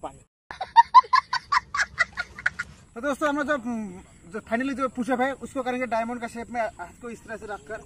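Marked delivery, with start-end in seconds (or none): 0.5-2.7 sudden high laugh after a water break; burst of laughter. Real, not acted. Coach laughs mid-workout after calling for water. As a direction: let out a loud laugh.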